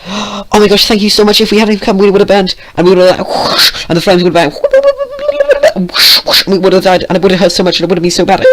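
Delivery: high, loud breathless pony gush; rapid babbling. Acted. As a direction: rattle it off excitedly. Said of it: "said all of this so fast".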